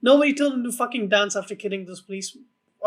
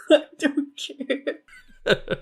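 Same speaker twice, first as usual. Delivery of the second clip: laughing; 4 semitones higher, much slower than usual. Real, not acted. Laughing over being cancelled.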